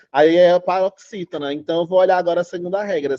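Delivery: ordinary delivery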